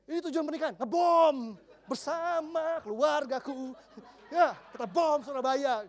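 mock the bomber family's twisted cheer; a laughing jab at a bombing family; sarcastic mock voice.

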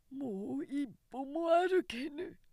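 slow, high, lightly voiced old man pleading; a hint of an exhausted elder begging; slow, feeble old man's plea; weary old man. A narrator's reading. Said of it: "と訴える老人もある".

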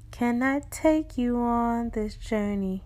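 slowed, deliberate invitation. Acted. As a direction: draw out "Can I take you on this journey". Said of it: "repeated invitation, slowed".

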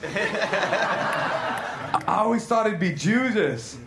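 slow, cracking up mid-line; barely getting the words out for laughing; slow line broken by laughter; laughing through it. Acted.

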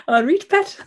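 high, loud Geordie-style greeting; northern accent greeting. Acted. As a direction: put on a northern "pet" greeting. Playful greeting in put-on northern dialect.

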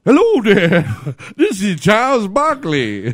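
slow and hammed up, introducing himself; jokey impression of the TV analyst; Charles Barkley impression. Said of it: "What would Charles Barkley sound like?".

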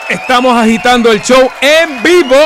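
loud, booming show opener; radio announcer. Acted. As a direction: announce it big to the whole island. Abre el show en vivo. (locutor de radio con voz fuerte)

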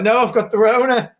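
high, loud panicked cry; mock panic. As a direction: fake a dramatic covid scare. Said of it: "be like".

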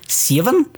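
put-on "Seven?" said as "sivven", rising high in a Kiwi accent; New Zealand accent. Showing how New Zealanders say "Seven?", as "sivven", rising. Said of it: "to say seven?".

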